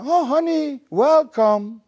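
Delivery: high, bright gushing greeting; delighted welcome. Acted. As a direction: sweep him in with delight.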